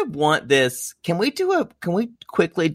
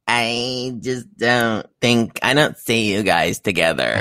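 slow imitated remark; 2 semitones lower, slower than usual. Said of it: "Okay, like".

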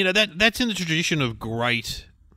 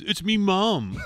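higher-pitched mock yell; imitated protesting yell; 8 semitones higher, much slower than usual. Young guy to kiss cam crowd, mock protesting yell. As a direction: act out an embarrassed lad's protest. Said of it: "and he yells at".

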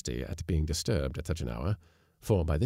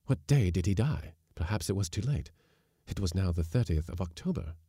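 plain reading voice

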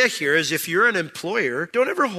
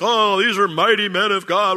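higher, louder mock awe; mock reverence; 6 semitones higher, 7 dB louder than usual. Congregation venerating apostles, in mock awe. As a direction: gush over them in mock admiration. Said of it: "We're like".

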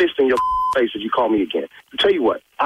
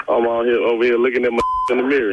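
laughing; 4 semitones lower than usual. Real, not acted. Laughing after prank reveal.

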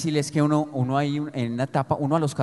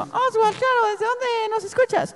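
put-on very high, squeaky boy DJ voice; mimicking his teen self on the radio, high and squeaky; cracking teen radio voice; slower than usual. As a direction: imitate his voice breaking on air. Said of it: "cuando me estaba cambiando la voz".

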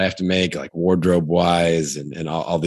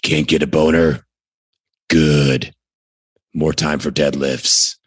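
low, gruff, stern promo read; Jocko Willink voice; 3 semitones lower than usual. Pretend. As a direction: bark it like a hardcore coach. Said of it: "that's my Jocko Willink".

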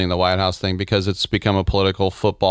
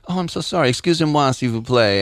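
higher, mock-sweet French "Excusez-moi"; mock French apology; 4 semitones higher than usual. Impersonating the French player after a foul, fake-sorry French. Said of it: "he'd be like".

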